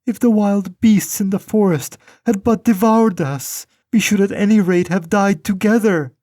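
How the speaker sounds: put-on crying girl. A narrator's reading. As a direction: beg God for help in tears. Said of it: "she cried".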